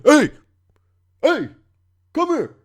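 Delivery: imitated high-pitched police holler; mock cop hailing a jaywalker; cops calling out.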